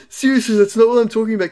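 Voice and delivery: mock-scold the kid in one quick burst; hammed-up frantic mother; exasperated mum. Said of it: "She's like".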